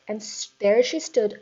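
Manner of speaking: usual speaking voice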